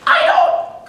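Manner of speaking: sudden falsetto yelp, way up high; high-voiced patient's shocked squeak; high-voiced man. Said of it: "he has this unnaturally high voice".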